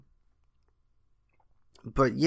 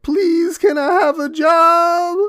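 very high pleading whine, drawn out; begging high voice; 10 dB louder, slower than usual. Retold or imitated. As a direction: beg in a squeaky pleading voice. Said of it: "Kurt Connors was like".

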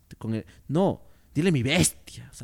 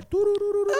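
high mock trill sound; 4 dB louder than usual. Mocking a woman moving wildly, high trill. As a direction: rattle off a silly high trill.

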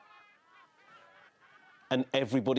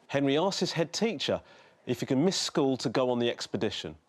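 ordinary delivery

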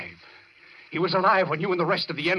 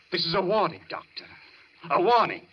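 menacing warning; 6 semitones higher than usual. Acted. Patient threatening his doctor, rising into wild raving. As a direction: warn him, then let the madness break loose.

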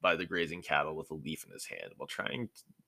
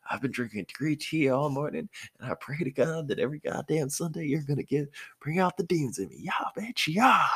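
manic hype; 10 semitones higher than usual. Acted. Naruto rampaging with clones.

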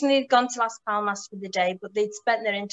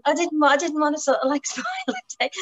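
sheepish laugh; 7 semitones higher, 4 dB louder than usual. Retold or imitated. Mike apologetic, laughing.

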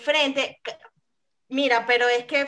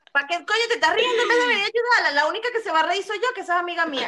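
higher, fast indignant scolding; retold telling-off; 7 semitones higher, faster than usual. Scolding men who laughed at her friend, fast and indignant. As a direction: rip into the two idiots.

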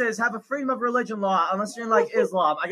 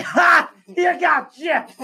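taunting dog voice; 6 semitones higher, much slower than usual. Retold or imitated. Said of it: "Dog's like".

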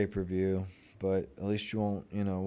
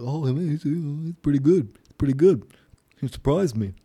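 higher, louder mocking pundit voice; mocking quote; 6 semitones higher, 8 dB louder than usual. Mocking the boxer's praise of Logan Paul in a high, loud put-on voice. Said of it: "And I saw, like".

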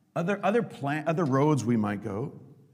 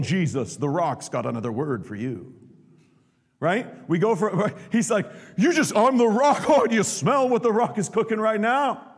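cocky Peter; 8 semitones higher, 6 dB louder than usual. Retold or imitated. Peter voiced cocky and boastful.

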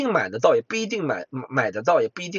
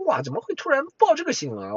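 surprised; 10 semitones higher than usual. Pretend. Imagined frightened listener.

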